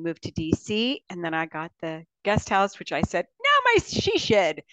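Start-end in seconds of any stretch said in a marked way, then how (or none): 3.4-4.6 quoted triumph. Retold or imitated. Quoting herself, proud.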